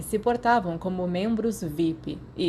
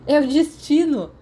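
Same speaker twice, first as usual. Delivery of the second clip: higher and louder, proclaiming it; excited declaration; 8 semitones higher, 7 dB louder than usual. A narrator's reading. James thrilled that Lily is also head girl, loud and high. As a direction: announce it like a grand revelation. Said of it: "James declarou".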